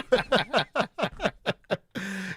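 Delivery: real, sustained giggling fit; laughing. Real, not acted. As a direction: crack up in a long laugh. Cracking up at accent attempts.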